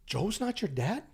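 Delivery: imitated disbelief, pitch lifted; retold surprise. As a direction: act out a friend's surprised question. Retold surprise at adoption news.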